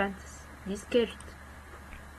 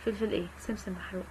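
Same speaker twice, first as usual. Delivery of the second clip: ordinary delivery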